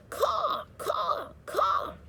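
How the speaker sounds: high screeching caw caw caw; crow cawing. Acted. Crow screaming at the rabbit on the pole, high cawing. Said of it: "screamed a dark message".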